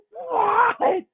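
high, drawn-out cry of disbelief; mock angry outrage. Kid betrayed by librarian's book pick, hammed-up outrage. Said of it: "I was so angry that this was the book".

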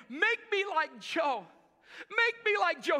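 high, pleading, imitating a sob; crying plea. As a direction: beg through mock tears. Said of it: "and he's crying".

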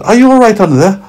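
put-on high, loud, quick boy voice; boy asking the carrot under the pillow; concerned little boy. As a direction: check on your friend in a child's voice. Boy checks on hidden carrot.

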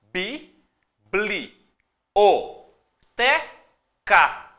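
"Bi, bli, o, te, ca" split into separate syllables with pauses. Shown as a demonstration. Teacher separating syllables for students, syllable by syllable. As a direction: say "Bi, bli, o, te, ca" one syllable at a time. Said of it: "Só separa em sílaba".

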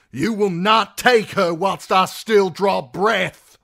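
high, loud, slow declaration; defiant shout. A narrator's reading. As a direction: hammer out a vow of defiance. Knight defies the guards.

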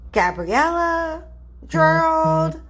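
ordinary delivery

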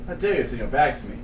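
lightly voiced quiet imperial challenge; Gladiator quote. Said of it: "My name is Maximus Decimus".

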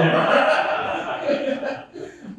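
airy giggle up high; amused breathless laughter; high breathy laugh. Real, not acted. Laughs retelling wife's look.

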